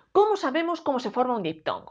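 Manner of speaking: normal talking voice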